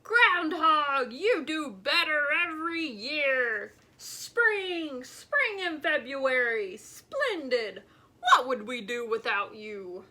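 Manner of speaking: loud delighted roar. A narrator's reading. Bear roaring with delight at the groundhog's news. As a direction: roar it with happy laughter. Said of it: "he roared with delight".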